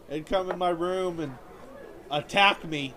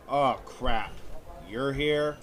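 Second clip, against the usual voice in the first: dad voice; 6 semitones lower, much slower than usual. Retold or imitated. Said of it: "and my dad was like".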